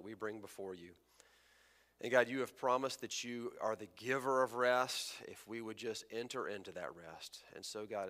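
soft, hushed prayer voice. Acted. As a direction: pray quietly and humbly. Pastor prays before sermon.

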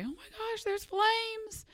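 high, alarmed yelp of discovery; panicked cry. Retold or imitated. Nephew spotting flames at the back door, alarmed high cry. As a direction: sound the alarm, pitch jumping way up.

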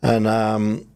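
normal talking voice

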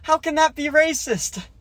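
incredulous hero. Retold or imitated. Host mocking the hero's disbelief at a robot, high and loud. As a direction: squeal it out in disbelief. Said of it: "And the guy's like".

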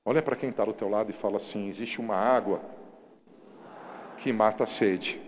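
loud, driving sermon voice; fiery pulpit preaching; preaching at full force. Acted. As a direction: preach it out to the whole church. Calls the crowd to repeat.

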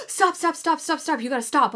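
high, urgent repeated stop; urgent nurses. Retold or imitated. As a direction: bark the stops like a nurse. Nurses quoted urging.

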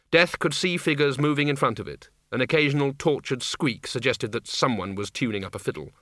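plain reading voice

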